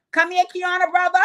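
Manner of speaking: high, loud call. Pretend. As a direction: shout him over. Calls out to Kiana's brother.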